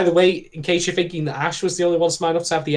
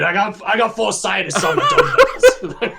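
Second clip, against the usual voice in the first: laughing; 4 semitones higher, 4 dB louder than usual. Real, not acted. Joking, laughing.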